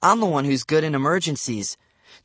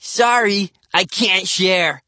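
put-on cheeky boy; 5 semitones higher, 4 dB louder, slower than usual. A narrator's reading. Cheeky boy hogging a cupcake.

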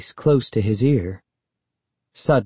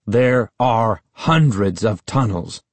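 heated. A narrator's reading. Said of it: "Uncle Ben said heatedly".

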